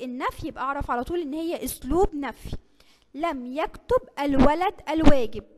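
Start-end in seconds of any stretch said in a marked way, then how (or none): 3.1-5.4 loud slow example. Shown as a demonstration.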